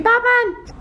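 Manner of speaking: high startled call of a name; sudden squeaky surprise; surprised. Real, not acted. Surprised at Batman outside window.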